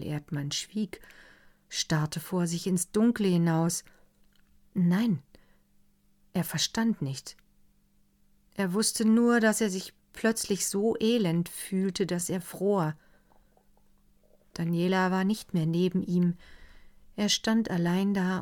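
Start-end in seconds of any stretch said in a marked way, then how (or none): none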